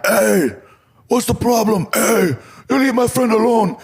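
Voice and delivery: big wrestler yelling at airport guards to back off; shouting from afar. Retold or imitated. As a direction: bellow it from down the concourse to protect a friend. Haku shouting across the concourse.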